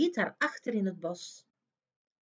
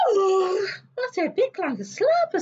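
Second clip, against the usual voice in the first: high, louder amazed sigh; waking wonder; 10 semitones higher, 7 dB louder than usual. A narrator's reading. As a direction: wake up astonished. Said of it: "zei het meisje".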